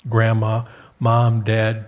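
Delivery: normal talking voice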